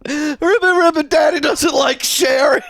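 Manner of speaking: high, giggling echo of the punchline; gleeful quote dissolving into laughter; laughing repeat. Retold or imitated. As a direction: repeat it while cracking up. Repeats the tag while cracking up.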